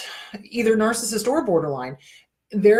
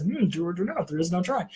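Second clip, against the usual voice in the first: a hint of Yoda, quieter, humming first; quiet wise-master line after lifting it; softer mild Yoda voicing; 3 semitones lower, 6 dB quieter than usual. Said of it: "then says".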